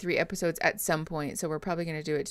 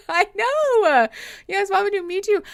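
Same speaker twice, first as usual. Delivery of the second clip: excited; 12 semitones higher, 7 dB louder than usual. Real, not acted. Agreeing happily with chat.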